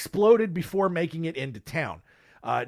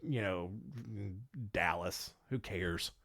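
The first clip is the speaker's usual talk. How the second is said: indifferent; 7 semitones lower, 4 dB quieter than usual. Retold or imitated. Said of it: "it's like".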